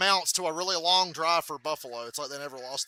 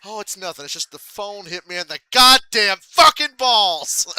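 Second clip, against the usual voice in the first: angry complaint; 5 semitones higher, 14 dB louder than usual. Retold or imitated. Explaining the phone hit him.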